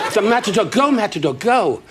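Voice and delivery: hammed-up excited wife; mock cheering. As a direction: cheer like a fan at the ring. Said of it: "she talks like this".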